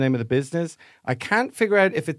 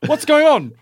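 1 dad's greeting; 8 semitones higher than usual. Retold or imitated. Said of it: "he's like".

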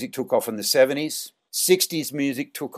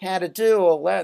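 high, loud, hammed-up Australian accent; put-on Australian accent; 9 semitones higher, 5 dB louder than usual. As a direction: play the clueless Australian, pitched way up. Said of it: "put the accent on and go".

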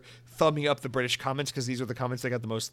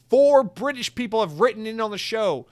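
excited; 8 semitones higher, 9 dB louder than usual. Real, not acted. Gleefully announcing British write-ins.

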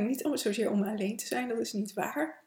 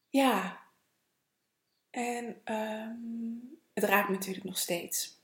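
ordinary delivery

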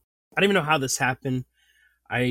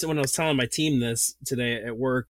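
normal talking voice

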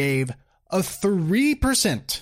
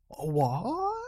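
hammed-up incredulous question; exaggerated disbelief; 4 semitones lower, 7 dB quieter than usual. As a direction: stretch it upward, utterly baffled. Disbelief at the denial.

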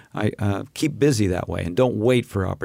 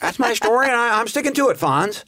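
higher, lightly voiced sitcom teen; sitcom character voice; 11 semitones higher than usual. Guest plays a sitcom friend calling Fonz, higher. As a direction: pitch it up like a sitcom buddy. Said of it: "something he would say on happy days".

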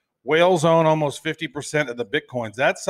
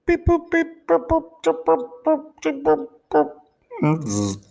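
very high beeping robot. Pretend. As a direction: beep and boop like a malfunctioning robot. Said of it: "I think he just shorted out".

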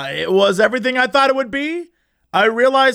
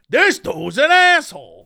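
slow, higher-pitched condemnation; mock judgmental; 5 semitones higher, slower than usual. Acting out a snap judgment, drawn-out mock contempt. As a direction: draw out the mock verdict.

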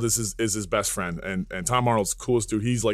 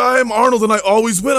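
high, loud, triumphant mock boast; Arnold Schwarzenegger; 9 semitones higher, 8 dB louder than usual. Imitating a star boasting of victory, loud and high. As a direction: proclaim it as a hammed-up champion. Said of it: "He's like, he's like".

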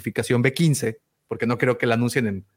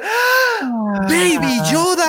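much higher, louder thrilled scream; mock crowd squeal; 14 semitones higher, 3 dB louder, much slower than usual. Imitating the cinema crowd screaming at the trailer, a high hammed-up squeal. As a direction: squeal like a whole cinema seeing Baby Yoda. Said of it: "todos en el cine van a gritar".

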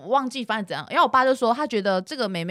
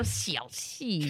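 Hakka-accented mock; 6 semitones lower, 11 dB quieter than usual. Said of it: "客家人讲话是不是这样啊".

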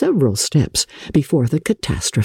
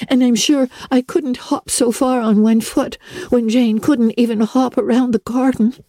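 put-on high, pleading girl's voice; hurt young Anne; 10 semitones higher than usual. A narrator's reading. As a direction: beg her friend through pain. Injured Anne pleads for help.

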